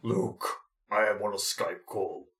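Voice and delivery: low Darth Vader parody voice; a hint of Vader intoning over a call. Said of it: "That is a quote from The Empire Strikes Back".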